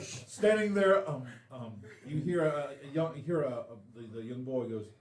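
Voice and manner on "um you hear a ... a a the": normal talking voice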